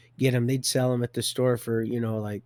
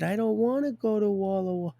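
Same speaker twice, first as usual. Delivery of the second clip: mock whine; 9 semitones higher than usual. Retold protest in quoted exchange.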